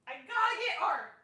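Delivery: shouting way up high and loud; excited drunken shout at friends; drunken yell. Retold or imitated. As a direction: yell it like you can't wait another second. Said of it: "I just start yelling".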